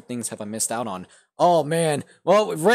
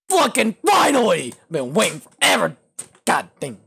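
excited yell; 4 semitones higher, 5 dB louder than usual. Real, not acted. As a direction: shout it like the wait is over. South Park finally airing tonight.

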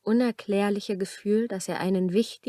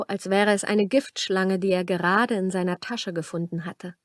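ordinary delivery

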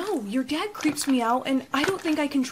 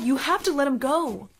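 ordinary talk, nothing marked

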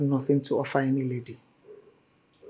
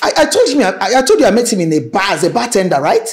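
raised voice; 3 semitones higher, 9 dB louder than usual. Acted. Mother argues about the boyfriend.

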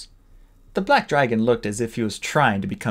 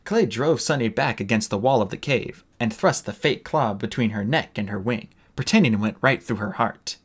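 usual speaking voice

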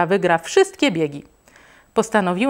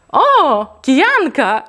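mild surprise; 7 semitones higher, 5 dB louder, much slower than usual. Acted. Father surprised by the jar.

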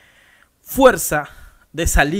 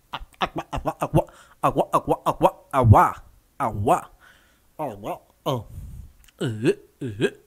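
rapid repeated agua scratches; vocal scratch. Shown as a demonstration. Teacher demos scratch pattern after explaining, vocal scratch. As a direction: chop agua into record-scratch bursts. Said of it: "dice agua".

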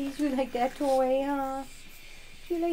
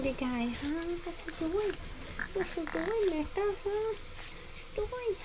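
high sing-song puppy talk; high cooing pet voice; baby talk to puppies; 6 semitones higher than usual. Acted. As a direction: coo at the dogs in a high voice.

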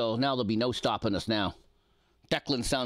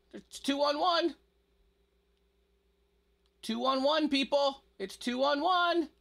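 yelling; 13 semitones higher than usual. Real, not acted. Gamer yelling at teammates.

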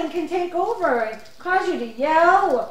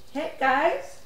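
ordinary talk, nothing marked

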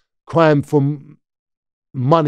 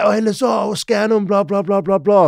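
high, breezy imitation of the older boys; lightly mimicked teenage charm; older boys' greeting; 6 semitones higher than usual. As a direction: toss it off like a cocky older kid. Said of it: "the older boys would be like".

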